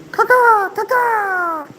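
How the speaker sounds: very high bird call; chirping bird person. Acted. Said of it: "How'd that go again?".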